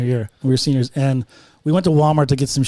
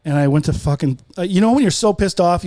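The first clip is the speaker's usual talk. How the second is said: usual speaking voice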